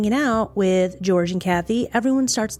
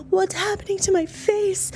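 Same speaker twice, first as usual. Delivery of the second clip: much higher, frantic, in a put-on voice; panicked cry; 12 semitones higher than usual. Kathy seeing her aged face, a high, frantic panicked cry. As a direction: cry it out as if terrified by your own face. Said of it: "Kathy's just yelling".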